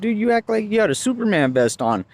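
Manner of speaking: high-pitched indignant jab; retold cussing out. Driver to puffed-up cop, retold indignation. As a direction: re-enact mocking an officer's swagger. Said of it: "I ended up cussing this one out".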